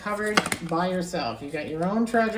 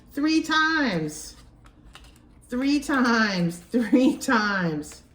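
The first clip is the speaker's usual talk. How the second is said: higher, excited disbelief breaking into a laugh; can't believe it came up again; amazed; 5 semitones higher, 4 dB louder, much slower than usual. Real, not acted. Reader to viewer, stunned the card appeared again, excited.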